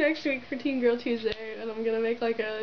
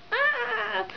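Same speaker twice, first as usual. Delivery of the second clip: excited squeal; 13 semitones higher than usual. Real, not acted. Overwhelmed by hug from idol.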